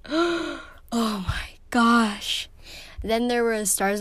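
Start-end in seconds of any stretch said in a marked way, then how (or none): 0.0-2.5 slow, drawn-out gasp into oh my gosh. Real, not acted. Vlogger recalling a book that made her sob, slow gasp. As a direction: let the memory catch your breath.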